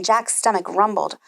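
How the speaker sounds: plain reading voice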